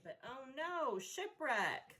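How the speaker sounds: ordinary talk, nothing marked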